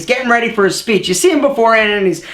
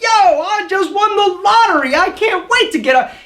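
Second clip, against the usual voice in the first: much higher, hyped-up, bursting with glee; lottery-win excitement; 9 semitones higher than usual. Retold or imitated. Picturing the moments before a speech, thrilled like a lottery winner. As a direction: sound like you just hit the jackpot. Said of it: "you're like".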